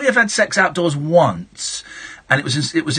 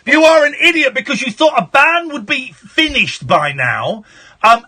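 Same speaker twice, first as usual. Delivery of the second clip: high, raised-voice telling-off; angry rant; 9 semitones higher than usual. Real, not acted. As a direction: lay into the caller indignantly. Host quarrels with banned caller.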